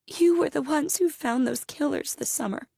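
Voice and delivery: high, anxious mother's voice; shaking voice. Acted. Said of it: "her voice shaking".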